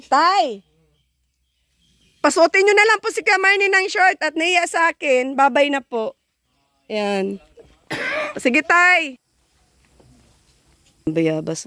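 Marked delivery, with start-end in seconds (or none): 0.1-6.1 high, raised-voice call. Real, not acted. Vlogger shouting a parting request to Tatay, calling out. Calls back to father while leaving. 8.4-9.2 high, loud parting call. Real, not acted. Vlogger taking leave of Tatay, calling out. Calls goodbye to father.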